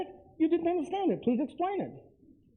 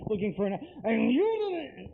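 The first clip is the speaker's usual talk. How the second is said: normal talking voice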